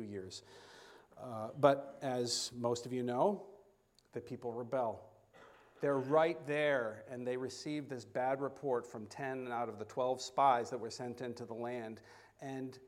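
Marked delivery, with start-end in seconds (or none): none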